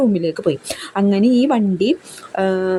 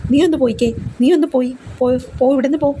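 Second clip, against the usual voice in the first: mock angry, higher-pitched go away; half-acted snap, pitched up high; imitating an angry sister; 5 semitones higher than usual. Sister angrily shooing the young girl away, mock angry and high. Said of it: "Babita ദേഷ്യപ്പെട്ടുകൊണ്ട് പറയും".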